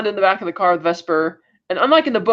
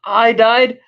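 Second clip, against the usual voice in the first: louder, higher mock disbelief; incredulous outburst; 5 semitones higher, 5 dB louder, much slower than usual. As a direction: throw it back loudly, as an imitated villain. Retold villain's incredulous outburst.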